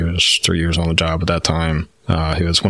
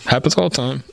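high, casual reassurance; breezy old lady; 9 semitones higher than usual. Retold or imitated. Counselor shrugging off his fear, high and breezy. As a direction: wave it off lightly in a sweet old voice. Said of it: "It's like".

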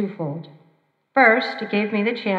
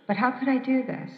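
ordinary talk

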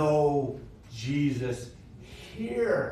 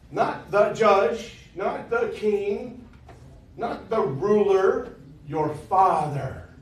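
each phrase slow, pitched higher and stressed; slow emphatic contrast; 4 semitones higher, much slower than usual. Shown as a demonstration. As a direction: build the list slowly, landing on "your Father". Building contrast list.

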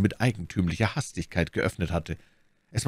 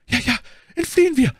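very high, loud, frightened cry; panicked agreement; 5 dB louder than usual. A narrator's reading. Bonacieux echoes Milady's call to flee, panicked.

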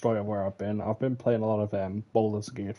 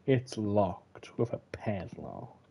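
slow, drawn-out imitation of calm; mock calm; much slower than usual. Player mimicking the game character's line, mock calm. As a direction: repeat it back with exaggerated calm, slowly. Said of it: "You say that so calmly".

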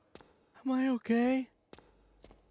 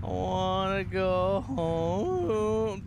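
slow, miserable scared moan; long, slow frightened moaning; drawn-out moan; much slower than usual. Real, not acted. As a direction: stretch each word into a long whine. Scared player moaning to leave.

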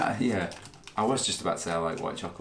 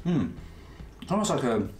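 chewing while talking; mouth full; 6 semitones higher than usual. Real, not acted. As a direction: speak through a mouthful. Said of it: "talk with your mouth full".

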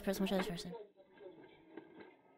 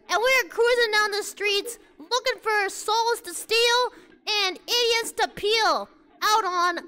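hype streamer intro; 4 semitones higher, slower than usual. Pretend. Announces self to Chinese street.

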